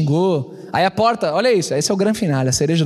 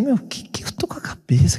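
whispered self-reproach; 5 semitones higher than usual. Retold or imitated. Inner shame after the fight.

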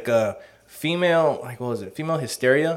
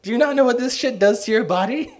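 high, shocked outburst; appalled doctor voice; 8 semitones higher than usual. Acted. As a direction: play a horrified doctor. Voicing appalled later doctors.

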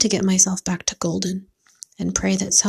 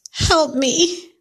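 higher, cracking cry for help; 7 semitones higher than usual. A narrator's reading. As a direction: shout it out over the empty highway. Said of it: "I cried as loud as I could, my voice cracking".

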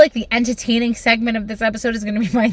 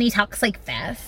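soft, slightly lowered mock dating voice; demo of unwanted voice; 3 semitones lower, 10 dB quieter than usual. Shown as a demonstration. Host showing how a bad date might talk, softly mocking. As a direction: lightly imitate a man's weak voice. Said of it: "if that voice isn't like".

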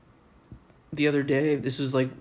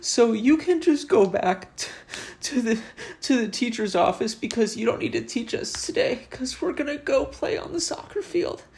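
put-on high, louder little girl voice with rising tune; 9 semitones higher, 4 dB louder than usual. Teacher retells pupil dismissing him, high girlish voice. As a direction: chirp it like a bossy little schoolgirl.

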